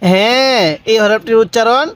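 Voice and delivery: usual speaking voice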